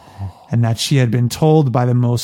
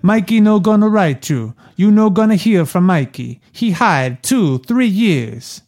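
put-on higher, heavy Italian Mama Corleone accent; strong Italian accent; 6 semitones higher than usual. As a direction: read her lines as a thick Italian mother. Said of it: "very strong Mama Corleone accent".

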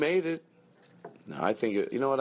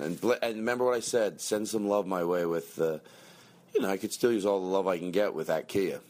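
ordinary talk

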